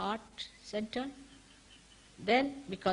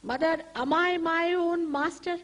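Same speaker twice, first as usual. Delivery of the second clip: higher, louder, earnest question; sincere question; 8 semitones higher, 5 dB louder than usual. Shown as a demonstration. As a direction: ask it with conviction. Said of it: "Here you have to ask the second question". (a sincere question, higher and louder)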